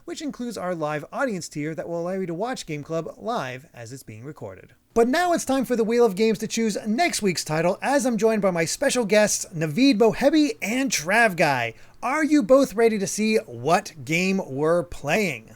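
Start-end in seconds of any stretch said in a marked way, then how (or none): 4.9-15.5 announcer. Acted. Host announces the game wheel.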